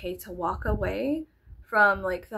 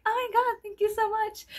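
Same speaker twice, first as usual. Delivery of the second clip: much higher, gushing sweet thanks; mock gushing thanks; 13 semitones higher than usual. Vlogger contrasts sweet thanks with rudeness, high gushing thanks. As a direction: gush it over the top. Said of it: "I went from, like".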